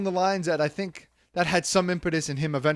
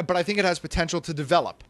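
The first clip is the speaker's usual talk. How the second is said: ordinary talk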